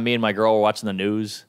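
normal talking voice

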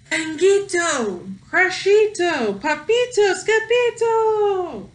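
high, loud, slow drawn-out calls; calling out. Acted. Mama calling the kittens down the hall, loud and high. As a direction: call each name up the hall.

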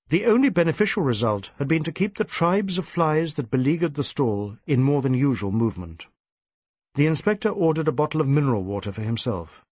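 plain reading voice

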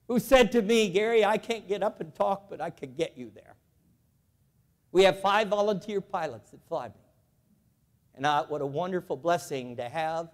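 ordinary talk, nothing marked

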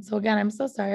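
ordinary talk

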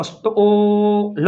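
સ્ટોલ with a long held 'o'; sounded out. Shown as a demonstration. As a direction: blend st slowly into સ્ટોલ, ending on a separate L. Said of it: "એની જેમ સ્ટ". (સ્ટોલ લંબાવીને)

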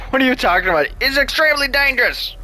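high, exclaimed mock warning; hammed-up, high-pitched exclamation; mock protest. Mock protest after dying repeatedly.

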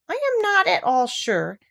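slow, high musing; teasingly thoughtful, drawn out. A narrator's reading. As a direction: ponder it slowly, pitched high. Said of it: "in a thoughtful voice".

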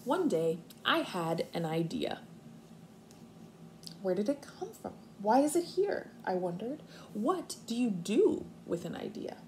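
expressive storybook reading for kindergartners; reading to children. Acted. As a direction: read it out like story time on the rug. Teacher reads picture book aloud.

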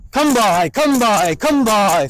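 high, loud calls to the dog; sing-song herding call; called dog command. Shown as a demonstration. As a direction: call it out across the field. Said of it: "quick demonstration of how this would go".

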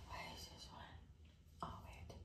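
hushed whispered question; whispering. Real, not acted. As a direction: whisper it while concentrating. Whispered while working closely.

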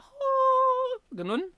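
sustained, very high "oh"; high-pitched woman's voice. Shown as a demonstration. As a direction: hold a high note like a woman singer. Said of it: "babaeng mataas yung boses niya". (mataas na boses ng babae)

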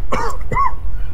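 harsh smoke cough; coughing fit. Acted. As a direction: hack after a hit. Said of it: "it still got me coughing".

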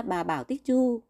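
usual speaking voice

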